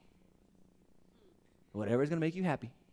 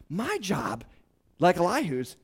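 ordinary talk, nothing marked

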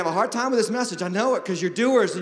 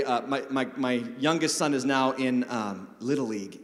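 ordinary delivery

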